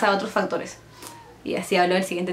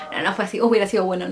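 ordinary talk

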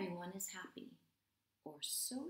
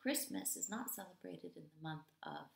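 ordinary delivery, nothing marked

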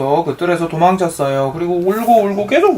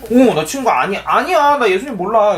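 higher-pitched acted denial; hasty denial; 6 semitones higher than usual. A narrator's reading. Peter disowns Jesus to the girl, in hasty denial. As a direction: deny it at once, pitch up. 겁먹은 베드로가 부인하는 대사.

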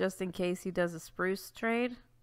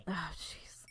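usual speaking voice

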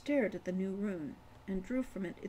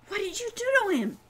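high, fast panicked cry; frightened boy asking fast; panicky; 12 semitones higher, 7 dB louder, faster than usual. A narrator's reading. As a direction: blurt it out in a panic. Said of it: "said Ren in a panicky voice".

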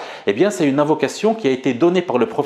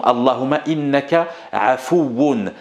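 ordinary delivery

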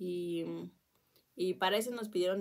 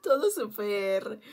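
laughing; 6 semitones higher, 9 dB louder, much slower than usual. Real, not acted. Laughing at fainting memory.

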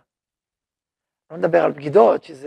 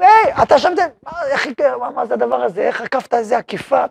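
high, startled wife's voice at the start, settling down; a wife catching him out, voiced higher; surprised wife; 5 semitones higher than usual.

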